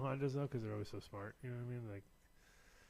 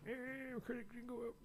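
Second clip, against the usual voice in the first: mock dopey voice; 14 semitones higher, 3 dB quieter than usual. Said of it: "I sit around, look at my credit".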